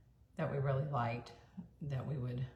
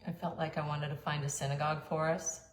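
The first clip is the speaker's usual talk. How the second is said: ordinary delivery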